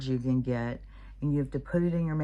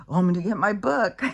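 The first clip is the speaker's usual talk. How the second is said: raised, quick, louder threat; hurried high-pitched threat, re-enacted; playful mock threat; 6 semitones higher, 7 dB louder, faster than usual. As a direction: call out a warning in a higher, louder voice. Mock threat to misbehaving child.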